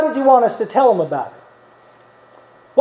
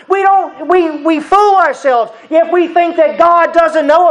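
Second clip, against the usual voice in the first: raised, earnest preaching voice; 7 semitones higher than usual. Acted. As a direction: drive the lesson home from the pulpit. Preaches the lesson.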